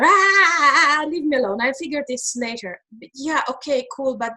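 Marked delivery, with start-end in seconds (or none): 0.0-2.8 brushing it off. Retold or imitated. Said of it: "and I".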